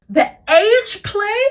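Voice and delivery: high, slow, drawn-out disgust. Acted. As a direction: stretch it out in revulsion. Disgusted emphatic outburst.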